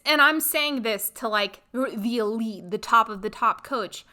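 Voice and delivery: ordinary delivery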